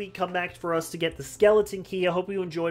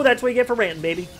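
usual speaking voice

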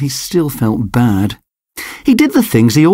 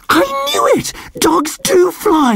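excited cry. A narrator's reading. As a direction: squeal it out in delight. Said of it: "he cried".

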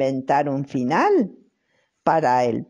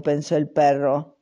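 ordinary delivery

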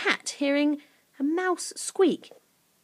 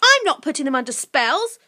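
put-on higher, louder girl's protest; indignant girl's denial; 5 semitones higher, 6 dB louder than usual. A narrator's reading. Girl denies the creature's accusation, protesting. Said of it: "protested Lucy".

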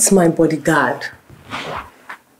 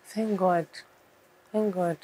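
soft, low murmur; subdued reply; 3 semitones lower, 14 dB quieter than usual. Acted. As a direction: murmur it quietly, withdrawn.

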